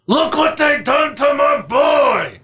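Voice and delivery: slow anguished shouted mob boss; Don Corleone. Shown as a demonstration. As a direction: roar it slowly as the grieving Godfather. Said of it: "It's my Don Corleone".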